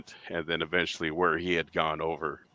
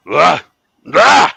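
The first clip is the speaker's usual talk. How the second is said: loud, higher mock bellow, rising sharply on the second; imitated roar; 9 semitones higher, 15 dB louder than usual. Partner imitating the creature's roar, loud mock bellow. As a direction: roar it out like a beast in the woods. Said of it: "I heard this".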